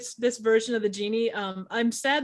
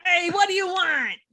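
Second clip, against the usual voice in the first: put-on higher, brash Jersey accent genie; Jersey-accented genie hustling customers; 7 semitones higher, 3 dB louder than usual. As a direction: play a pushy Jersey genie. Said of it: "genie with a Jersey accent".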